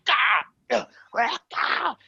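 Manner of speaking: very high-pitched "ah" yelps; mock attack cries. Brother swinging his meat cleaver, mock attack cries. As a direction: imitate wild swings with a cry each.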